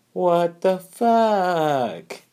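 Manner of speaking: long, incredulous what the fuck; stunned what the fuck. Real, not acted. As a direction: stretch it out in total disbelief. Reacting to Hillary hiring her.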